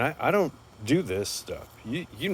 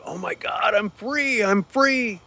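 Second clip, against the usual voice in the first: high, loud ecstatic yelling; joyful shout; 13 semitones higher, 9 dB louder, slower than usual. Retold or imitated. Freed prisoner yelling his joy down the street.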